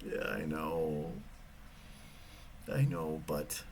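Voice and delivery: low, slow, reluctant concession; retold sheepish admission. Driver told it will cost big time, low sheepish reply. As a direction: concede it in a dropped, dragging voice.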